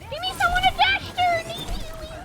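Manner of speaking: high loud plea for healing; desperate gamer yell for a healer; yelling for heals. Real, not acted. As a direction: shout it at the team. Begging for heals mid-fight.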